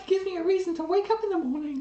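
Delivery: high, sweet lovestruck voice; lovey-dovey voice. Pretend. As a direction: gush it out like you're smitten. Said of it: "that's my lovey-dovey voice".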